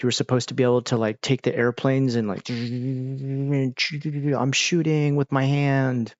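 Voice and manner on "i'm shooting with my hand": stretched-out mock plane gunfire; drawn-out gun noises. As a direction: play at shooting, drawing the sounds out. Acting out shooting at airplanes.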